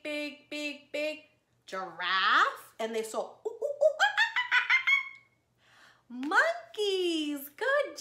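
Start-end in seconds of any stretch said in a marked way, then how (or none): 3.5-5.0 much higher mock monkey hoots; monkey noises. Shown as a demonstration. Teacher imitates zoo monkeys, high playful hooting. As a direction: hoot like a silly monkey for the kids.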